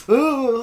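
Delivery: high, loud squeamish "ooh"; cringing ooh. Retold or imitated. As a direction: imitate wincing at something gory. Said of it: "they make you cringe".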